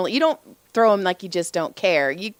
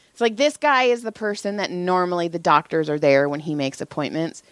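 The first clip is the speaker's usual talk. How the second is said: usual speaking voice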